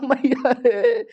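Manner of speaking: very slow, stretched moan of pain; drawn-out groan of pain.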